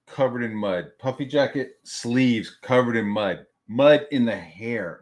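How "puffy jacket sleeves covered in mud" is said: usual speaking voice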